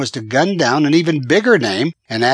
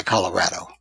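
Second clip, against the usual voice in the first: ordinary delivery, nothing marked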